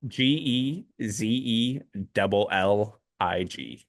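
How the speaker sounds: slow, spaced-out letters; spelling it out. Real, not acted. Host spelling a brewery name for co-host, slow and spaced.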